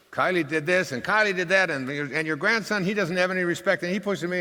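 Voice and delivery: put-on high, loud, complaining little girl; upset screaming girl. Sister running up to grandpa to complain, high loud tattling child. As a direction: wail the grievances like a hurt child. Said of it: "she's really screaming and all upset".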